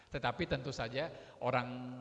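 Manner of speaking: usual speaking voice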